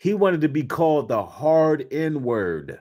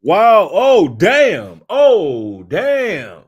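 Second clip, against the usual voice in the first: loud stunned wow, oh damn; real shock at what he just heard; 3 semitones higher, 8 dB louder, much slower than usual. Real, not acted. Shocked reaction to video.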